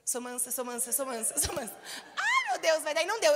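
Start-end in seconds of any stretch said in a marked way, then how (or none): 0.0-1.7 much softer, low repeated murmur; quiet mantra whispered to oneself; self-calming mantra. Retold or imitated. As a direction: mutter it under your breath to calm down. Calming herself before reacting.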